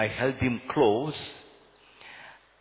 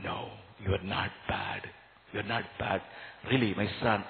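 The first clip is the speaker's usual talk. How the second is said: put-on softer, gentle comfort to a hurting child; soft reassurance; 7 semitones lower, 8 dB quieter than usual. Priest soothing the boy who feels bad, softly reassuring. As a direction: hush it down and reassure him.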